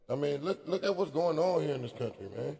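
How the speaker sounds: normal talking voice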